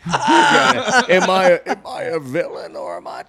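high, loud, put-on villain voice; booming echoing villain monologue. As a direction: ham up the grand villain question. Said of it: "if I could take a one voice".

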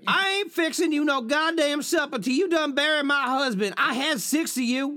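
put-on high Texas twang, threatening the kids; mock Texas mom. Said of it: "continues the Texas mom voice".

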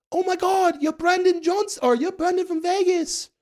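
put-on high, loud, gushing drunk fan with a British accent; drunk British girl. Drunk British girl spotting Brandon at dinner, squealing high and loud. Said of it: "there's this drunk British girl".

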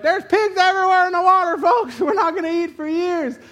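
high, loud hammed-up TV reporter; mock news reporter. Mock helicopter reporter telling folks about drowned pigs, hammed-up. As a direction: play a breathless helicopter newscaster.

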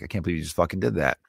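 low, soft, quick mock reproach; imitated quiet anger. Imitating a displeased wife to her husband, hushed anger. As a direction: scold under your breath, quickly. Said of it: "that evil look on her face".

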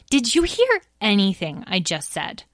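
usual speaking voice

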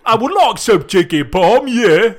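loud mock Cockney-Australian accent. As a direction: ham up an Aussie Cockney. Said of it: "Cockney and like Australian".

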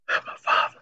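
breathy whisper of two words. Retold or imitated. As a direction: whisper it like a secret. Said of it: "He was like".

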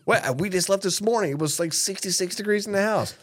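high, disbelieving mock dad; baffled dad. Dad confronting his son, high mock disbelief. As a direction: sputter like a fed-up father.

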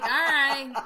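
loud drawn-out okay, then conceding; mock-defensive apology. Host retelling reply to Australian friend, mock-defensive apology. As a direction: act out backing off when corrected.

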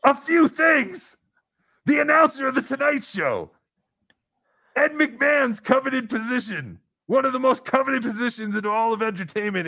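outraged, high-pitched rant; indignant outburst. Real, not acted. Indignant at being unimpressed by resume.

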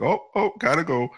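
high-pitched flustered caller; mock panic, hurrying off the phone. Acting flustered caller hanging up.

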